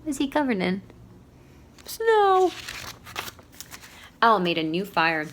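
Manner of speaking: ordinary delivery, nothing marked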